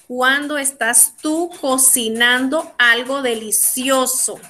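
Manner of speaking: slow, word-by-word question; slow dictation. Shown as a demonstration. Teacher sets class a question about cooking, slowly and deliberately. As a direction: space out each part for learners. Teacher gives sentence to translate.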